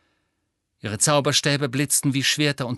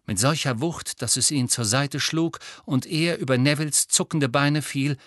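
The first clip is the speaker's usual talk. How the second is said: ordinary delivery, nothing marked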